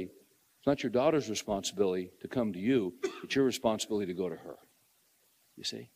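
ordinary delivery

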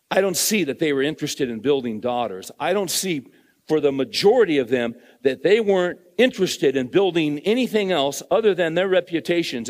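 loud, high, driving pulpit voice; fired-up preacher hammering his point home; full-force preaching. Acted. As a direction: preach it hard to the congregation.

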